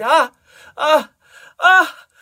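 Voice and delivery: loud high moan; climbing series of high, loud moans; ecstatic cries. A narrator's reading. Carmina at her climax, crying out. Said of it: "as she cried".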